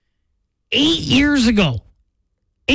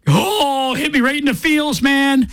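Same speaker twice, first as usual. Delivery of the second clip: high, strained, hammed-up emotion; squeezed high voice, as if near tears; mock choked up; 6 semitones higher than usual. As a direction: play at being hit hard by feelings. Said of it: "I'm gonna cry".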